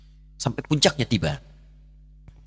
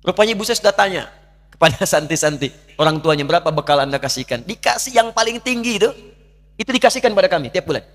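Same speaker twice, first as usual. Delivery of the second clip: higher, louder, pleading sermon voice; impassioned preaching; 7 semitones higher, 5 dB louder than usual. Acted. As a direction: preach it out with rising fervour. Preacher builds to emotional peak.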